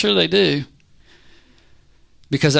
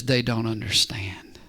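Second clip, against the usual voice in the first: calm, lowered voice of God; God standing there; 5 semitones lower, 3 dB quieter than usual. Acted. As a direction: answer low and quiet, with pauses. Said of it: "God stands there and says".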